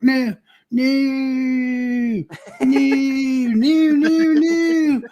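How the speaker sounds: squeaky high no no no; high whiny refusal. Pretend.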